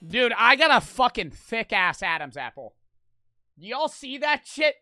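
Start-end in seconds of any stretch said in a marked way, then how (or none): none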